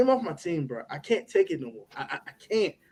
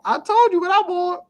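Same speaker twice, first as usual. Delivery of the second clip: much higher, insistent; high, exasperated insistence; 11 semitones higher, 4 dB louder than usual. Real, not acted. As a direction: hammer home the point, pitched up. Exasperated repeating his stance.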